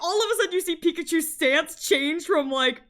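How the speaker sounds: usual speaking voice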